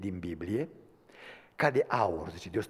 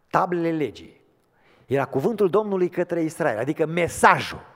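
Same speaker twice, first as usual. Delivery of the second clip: much louder and higher, ringing sermon voice; full-force preaching; 7 semitones higher, 11 dB louder than usual. Acted. Preacher explaining the ark's contents, full-force preaching. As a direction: proclaim it to the whole church.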